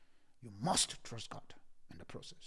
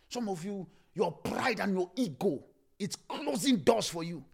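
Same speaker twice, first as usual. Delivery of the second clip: preaching shout; 5 semitones higher, 7 dB louder than usual. Acted. Preacher rebukes congregation.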